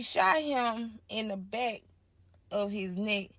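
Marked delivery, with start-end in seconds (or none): none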